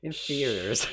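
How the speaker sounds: In theaters with a put-on American accent, theaters said high and loud; teasing American twang on theaters; mock American accent. Teasing a friend's slip, hammed-up American accent. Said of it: "I love the American accent".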